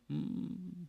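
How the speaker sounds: very soft drawn-out hum; sleepy groan. Acted. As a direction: answer with a drowsy mm. Sleeping son only groans.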